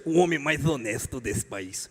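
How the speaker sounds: low, slow politician parody; drawn-out, deep mock-solemn leader voice; mock leader's boast. Mimics the leader's voice as joke.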